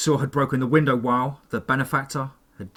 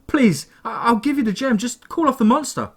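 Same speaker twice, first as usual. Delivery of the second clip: high, loud, desperate pleading; desperate begging; 12 semitones higher, 5 dB louder than usual. A narrator's reading. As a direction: beg for your life. Victim begs villain.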